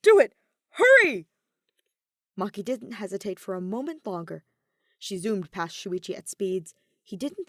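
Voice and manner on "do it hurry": much higher, louder urging; hurt astronaut urging her on; urgent shout. A narrator's reading. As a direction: hurry her, loud and anxious. Kaito urging Maki.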